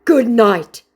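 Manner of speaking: high, loud abrupt goodbye; mock scammer voice. Vlogger mocking the scammer's exit, high and loud. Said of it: "said the scammer".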